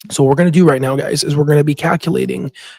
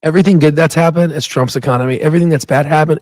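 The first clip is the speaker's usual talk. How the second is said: ordinary talk, nothing marked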